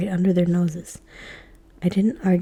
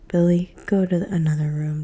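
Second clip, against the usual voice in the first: usual speaking voice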